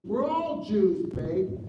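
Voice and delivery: high, slow breezy answer; mother's stock reply. Retold or imitated. Mother answering a stranger, high and breezy. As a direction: toss it off the way she always did. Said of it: "That was a standard answer".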